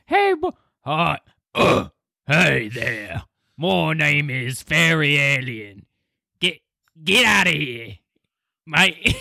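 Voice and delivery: slow, hammed-up British voice; mock British accent. Fairy alien greets the hosts in a mock British accent. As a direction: attempt a British accent, sliding into Australian. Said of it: "British accent, though".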